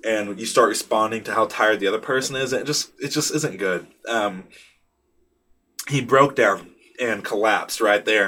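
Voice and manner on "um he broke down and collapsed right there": ordinary talk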